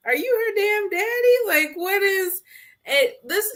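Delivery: acted-out incredulous reaction; mock incredulous. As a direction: call him out in high-pitched disbelief. Retells her incredulous reaction to Kenneth.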